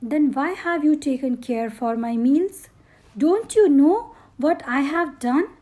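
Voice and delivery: angry queen, rising to a loud accusing shout. A narrator's reading. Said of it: "Her voice was rasping, harsh, and growing louder".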